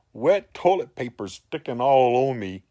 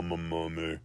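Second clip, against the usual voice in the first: mummy voice; 5 semitones lower, 9 dB quieter than usual. Retold or imitated. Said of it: "I'm trying to do the voice".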